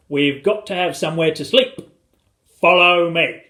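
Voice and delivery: hearty, full-voiced order; commanding father. A narrator's reading. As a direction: lead the troops off. Father leading the family.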